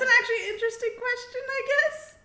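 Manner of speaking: high-pitched, quick, laughing aside; amused bafflement. Real, not acted. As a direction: say it through a rising giggle. Amused by the bathroom question.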